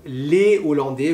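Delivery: "les Hollandais" with no z between words; "les Hollandais" said lé o-lan-dé, les stressed; no liaison. Shown as a demonstration. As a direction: keep les apart in "les Hollandais". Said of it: "vous ne faites pas de liaison".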